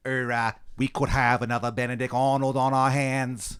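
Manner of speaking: hammed-up fake Boston accent; awful Boston accent. Colonist suspecting a traitor, awful Boston accent. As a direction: warn them in terrible Bostonese. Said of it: "he says in that awful accent".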